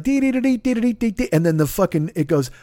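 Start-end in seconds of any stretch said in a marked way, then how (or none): 0.0-1.3 high, staccato vocal guitar notes; guitar riff imitation. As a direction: mimic a quick lead-guitar lick up high. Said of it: "it gets like".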